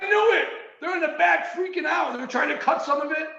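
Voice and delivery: high, shouted excitement; excited shout. Retold or imitated. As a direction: yell it like a thrilled son. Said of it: "He's like".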